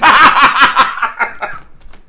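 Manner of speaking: loud high rolling laugh; the father of brilliant laughs; splendid laugh. Acted. Scrooge in the sitting room, long splendid laugh. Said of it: "it was a splendid laugh".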